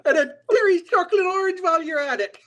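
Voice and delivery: laughing while putting on a leprechaun voice. Pretend. Joins the leprechaun bit.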